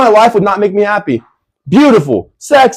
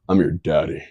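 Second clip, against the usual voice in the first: deep voice; 8 dB quieter than usual. Pretend. Joking deep tag line.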